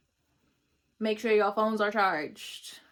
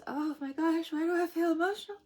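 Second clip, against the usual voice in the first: higher, close to tears, voice rising; suddenly emotional; 8 semitones higher, 6 dB louder than usual. Real, not acted. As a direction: let the emotion catch you off guard. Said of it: "feel like crying".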